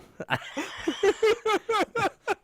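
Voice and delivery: high squealing laugh; real laugh, high. Real, not acted. Friend laughing at the sweaty-guy joke, high squeal.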